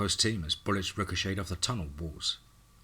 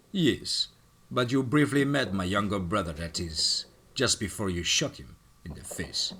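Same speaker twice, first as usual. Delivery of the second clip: put-on thick Russian accent. Russian villain greeting his enemy in a Russian accent. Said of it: "spoke in his thick Russian accent".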